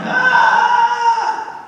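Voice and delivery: high loud crying wail; child's wail. Retold or imitated. A little girl crying to her grandma, loud wail.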